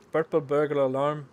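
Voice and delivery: loud mock Scottish accent. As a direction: shout it in a hammed-up Scottish accent. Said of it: "Say purple burger alarm in a Scottish accent".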